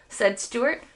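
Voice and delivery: ordinary delivery, nothing marked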